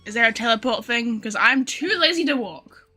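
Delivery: normal talking voice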